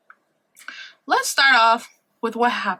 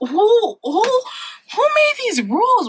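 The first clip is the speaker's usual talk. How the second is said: mock exasperation; 10 semitones higher, much slower than usual.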